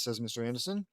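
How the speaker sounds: usual speaking voice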